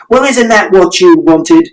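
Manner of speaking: high, slowed taunting yell; sneering shout. A narrator's reading. Father shouting back at mother. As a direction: taunt her with it. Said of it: "And Father shouted".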